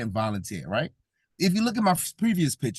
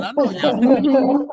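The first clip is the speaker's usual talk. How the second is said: slow, louder knowing tease, pitched up; teasing; 10 semitones higher, 9 dB louder, much slower than usual. Real, not acted. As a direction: drag it out like you caught them. Teasing the laughing audience.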